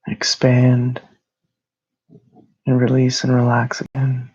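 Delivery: calm, even meditation voice with long pauses; guided meditation. Real, not acted. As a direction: guide them gently into rest, phrase by phrase.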